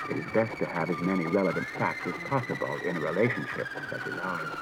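a polite machine stating facts; calm robot voice. A narrator's reading.

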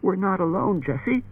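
hopeless, high-pitched warning; Bailey. A narrator's reading. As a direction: helplessly try to hush her. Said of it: "Bailey said hopelessly".

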